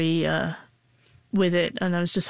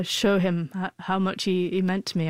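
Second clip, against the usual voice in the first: ordinary talk, nothing marked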